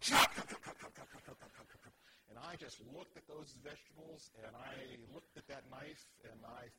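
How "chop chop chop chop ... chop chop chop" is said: loud sharp chop chop sounds, voiceless; angry chopping. Retold or imitated. Narrator mimics the wife's furious vegetable chopping. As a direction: hack out each chop like a cleaver. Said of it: "she was going".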